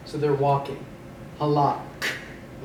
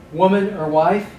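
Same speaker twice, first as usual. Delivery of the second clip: ordinary delivery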